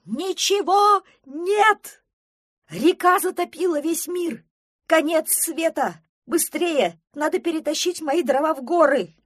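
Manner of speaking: wild, high, loud cries; panicked yelling. A narrator's reading. Пронырсон bursts from the woods about the flood, yelling. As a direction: yell it like the world is ending. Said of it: "Это вопил Пронырсон".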